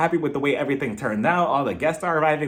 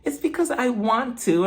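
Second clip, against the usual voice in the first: put-on high, slow child's voice; imitated little boy; 7 semitones higher, slower than usual. Little boy answering his scolding mother, in a high slow voice.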